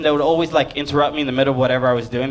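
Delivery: usual speaking voice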